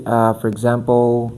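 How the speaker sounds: ordinary talk, nothing marked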